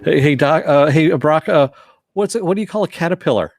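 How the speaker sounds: quick sing-song ribbing of a friend on the radio; playful taunt. Retold or imitated. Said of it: "Biscotti, yeah".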